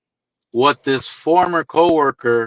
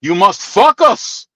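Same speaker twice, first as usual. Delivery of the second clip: put-on Greek accent; 3 semitones higher, slower than usual. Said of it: "that heavy ass accent".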